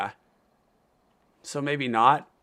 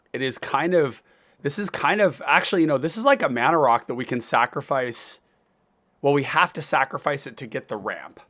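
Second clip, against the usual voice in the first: ordinary talk, nothing marked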